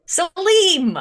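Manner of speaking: high, loud cry of delight; excited greeting shout. Acted. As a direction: call the name out in joyful greeting. Penn greets Salim with hug.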